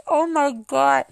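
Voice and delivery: high, loud "Oh my God"; mock exasperation. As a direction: blurt it out in disbelief. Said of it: "And I'm just like".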